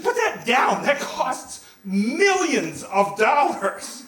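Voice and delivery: scolding nurse. Retold or imitated. Nurse ordering a teenager off costly equipment, scolding. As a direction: shout the order, stretch out the cost.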